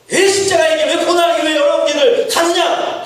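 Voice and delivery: mock devil yelling why; tempter shouting. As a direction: hurl the question like the devil. Preacher voices Satan's challenge.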